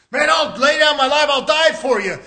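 loud, high, impassioned pledge; fervent vow. Acted. Peter pledging his life to Jesus, fervent loud vow. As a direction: swear loyalty with full voice.

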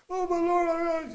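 very high, loud mock wail; hammed-up cry to heaven, cut off; mock wailing prayer. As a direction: imitate a frantic petitioner.

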